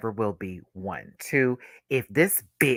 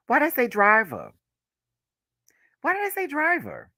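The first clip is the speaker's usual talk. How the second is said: high-pitched, incredulous self-questioning; high, real bafflement; baffled self-questioning; 12 semitones higher than usual. Real, not acted. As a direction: question your own slip, voice shooting up. Baffled at own slip.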